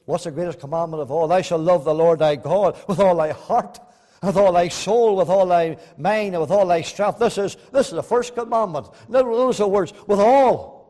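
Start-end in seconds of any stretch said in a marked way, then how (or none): 1.3-10.8 loud, high, stressing each all; full-force preaching. Acted. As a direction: thunder the commandment out.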